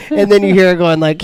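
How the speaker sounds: ordinary talk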